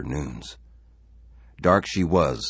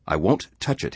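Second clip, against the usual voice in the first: firm refusal; 4 semitones higher, 6 dB louder than usual. Acted. Husband refuses the supper.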